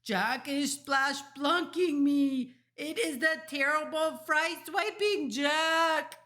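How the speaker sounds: high, slow, full-volume wailing; terrified bellow. A narrator's reading. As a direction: giant bellowing in nightmare panic. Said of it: "bellowed the flesh lump eater".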